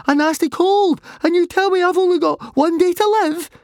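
very high, loud squeal of disbelief; outraged protest. A narrator's reading. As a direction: shriek it in indignant panic. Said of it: "he protested".

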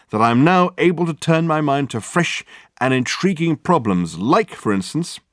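theatrical lead-in to a reveal; grandiose detective. Acted. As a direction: declaim it, slowing towards the end.

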